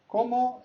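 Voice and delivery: CÓMO pitched high, then estás drops. Shown as a demonstration. Teacher models a greeting question, CÓMO high-pitched. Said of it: "high pitch because it's a question".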